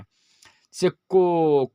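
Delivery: "को" drawn out very slowly from the throat; throat sound demo. Shown as a demonstration. Teacher showing a throat consonant, very slow. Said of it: "direct कंठ से ही आवाज़ आता".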